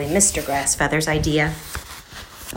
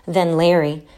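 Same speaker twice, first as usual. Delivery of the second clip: ordinary delivery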